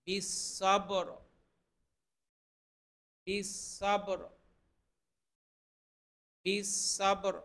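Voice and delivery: বিসবর with an echoing bounce on the ব; qalqala on ba. Shown as a demonstration. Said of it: "বায়ের মধ্যে কিন্তু কলকলা হবে".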